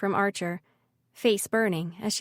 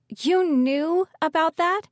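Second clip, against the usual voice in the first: higher, louder, slow astonished question; shocked; 8 semitones higher, 5 dB louder, slower than usual. Acted. Ridley learns her brother knew her secret, shocked. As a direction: caught out, she can't believe he knew.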